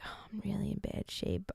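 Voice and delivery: mock whisper. Mocking a dramatic patient.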